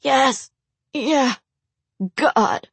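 high, bitter spat words; spitting out. Acted. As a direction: spit the words out. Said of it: "Raymond spat".

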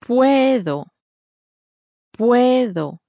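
slow, drawn-out first syllable. Shown as a demonstration. Native speaker modelling the word for learners, slowed down. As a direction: say it slowly, leaning on the first syllable. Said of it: "but slowed down slightly".

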